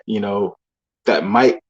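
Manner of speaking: ordinary delivery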